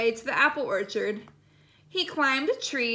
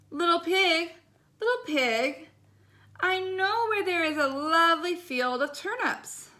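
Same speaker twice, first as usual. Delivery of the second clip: lightly raised sly coaxing wolf voice; 4 semitones higher than usual. Acted. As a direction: lure the pig in, a touch sly. Wolf coaxes pig with turnips.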